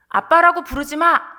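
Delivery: retold cold rebuke; cold, stern. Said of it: "굉장히 냉정하게".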